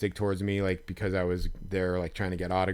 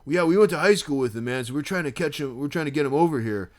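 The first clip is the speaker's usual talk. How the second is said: put-on drunk fan; 5 semitones higher, 5 dB louder than usual. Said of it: "he's like".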